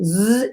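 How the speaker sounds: buzzing "zzz" blender noise; imitated blender buzz. Shown as a demonstration. Host demonstrating blending the cherries, blender buzz.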